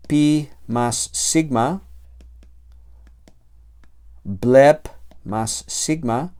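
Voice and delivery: slow, deliberate sum of sounds; slow demonstration. Shown as a demonstration. Teacher spelling out the sound change slowly. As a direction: lay out each piece slowly. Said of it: "teacher showing the sum".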